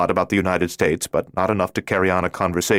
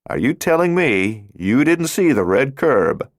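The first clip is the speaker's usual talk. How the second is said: higher, slow, teasing police officer; curiously playful; 7 semitones higher, 3 dB louder, slower than usual. A narrator's reading. Officer teasing the driver about the red curb, curiously playful. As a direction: toy with him like a cop enjoying a catch. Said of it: "in a curiously playful tone".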